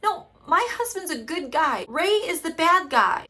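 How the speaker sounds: hammed-up defensive wife; mock pissed wife. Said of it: "she's kinda pissed".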